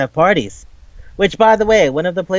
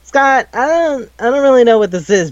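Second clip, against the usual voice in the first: high, halting then rushing; flustered, unsure how to respond; awkward hesitation; 8 semitones higher, 3 dB louder than usual. Retold or imitated. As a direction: uncomfortable mock fluster, pitched up. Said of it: "It's like".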